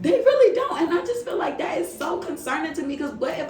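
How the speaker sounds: squealing laugh of agreement. Real, not acted.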